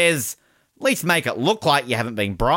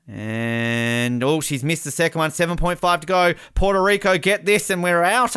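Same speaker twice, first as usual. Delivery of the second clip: ordinary delivery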